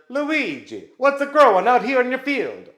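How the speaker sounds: loud, high, hammed-up Italian accent; terrible Italian accent. Someone calling to Luigi in his field, in a loud, hammed-up Italian accent. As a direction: ham up a bad Italian accent calling across. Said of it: "I did do a very terrible Italian accent".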